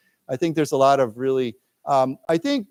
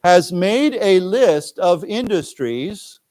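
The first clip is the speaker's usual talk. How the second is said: slow, louder phrase for students to repeat; slow read-aloud; 4 semitones higher, 5 dB louder, much slower than usual. Shown as a demonstration. Teacher reads line aloud for class to echo, slow and deliberate. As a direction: stretch each word out like a model reading. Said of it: "let's read this".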